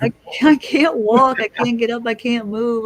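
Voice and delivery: frantic cry of I can't walk; panicked. Retold or imitated. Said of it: "I was freaking out".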